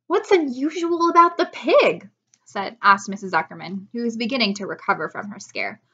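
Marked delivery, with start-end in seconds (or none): 0.1-2.1 high, loud, incredulous wife; baffled question. A narrator's reading. Wife asks what is unusual, baffled. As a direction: push the question up in disbelief.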